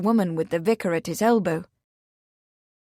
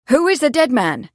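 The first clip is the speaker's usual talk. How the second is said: louder, higher question; urgent; 5 semitones higher, 6 dB louder than usual. A narrator's reading. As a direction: press for the name.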